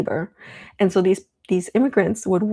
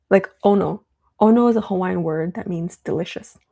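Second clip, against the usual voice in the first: ordinary talk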